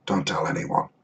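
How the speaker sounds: hushed conspiratorial dwarf line; whispered aside. Retold or imitated. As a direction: mutter it like a secret.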